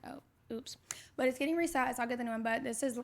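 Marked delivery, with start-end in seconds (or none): 0.0-0.8 a small sheepish whisper; whispered oops. Retold or imitated. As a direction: breathe it out, caught off guard. Finding out her ring size.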